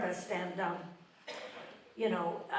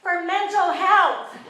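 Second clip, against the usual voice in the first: slow, higher, loud deadpan answer; drawn-out answer, higher and louder; plungers' reply; 8 semitones higher, 12 dB louder, slower than usual. Retold or imitated. Cold plungers answering the comic, slow loud reply. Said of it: "And they went".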